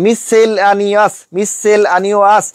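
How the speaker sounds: very slow "mis-sel-ani-as"; মিসেলানিয়াস drawn out syllable by syllable for spelling; spelling pronunciation. Shown as a demonstration. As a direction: stretch মিসেলানিয়াস piece by piece. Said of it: "এইভাবে spelling বললে".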